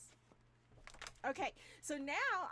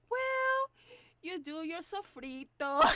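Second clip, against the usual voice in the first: mom's voice; 4 semitones higher, 9 dB louder, slower than usual. Retold or imitated. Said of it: "And she goes".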